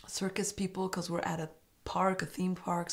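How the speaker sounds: breathy secret whisper, tossed in under the breath; whispered aside. Real, not acted. Vlogger whispering her guess about date guests, hushed. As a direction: let the guess slip out in a whisper.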